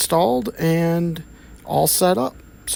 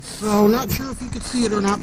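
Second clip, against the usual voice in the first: usual speaking voice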